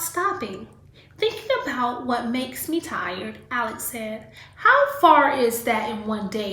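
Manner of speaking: lively read-aloud storytelling voice; reading to children. Acted. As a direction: read the story to a class of kids. Teacher reads picture book aloud.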